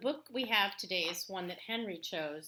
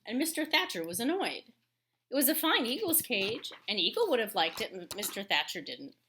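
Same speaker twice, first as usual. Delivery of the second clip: louder, measured read-aloud voice; story narration; 2 semitones higher, 6 dB louder than usual. A narrator's reading. Teacher reading a picture book to her class, storytelling voice.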